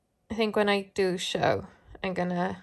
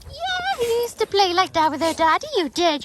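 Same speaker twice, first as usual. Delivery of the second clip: pet talk voice; 12 semitones higher, 8 dB louder than usual. Real, not acted. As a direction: coo at the dog, high and bright. Talking to dog in pet voice.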